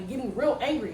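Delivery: usual speaking voice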